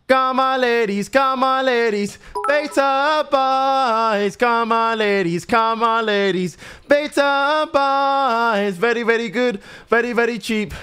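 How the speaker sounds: high, stretched-out hawker chant; market trader's cry. Pretend. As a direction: sing out the sales pitch to passers-by. Hawking the shop to passers-by.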